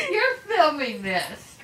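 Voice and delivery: laughing. Real, not acted. Grandma caught being filmed.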